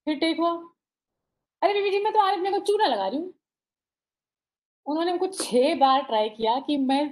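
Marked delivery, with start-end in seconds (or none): 1.6-3.3 fast, high, chirpy chatterbox; high and quick, playful maid's line; bubbly fast chatter. Acted. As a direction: rattle it off like an excitable maid. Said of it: "Chulbuli सी".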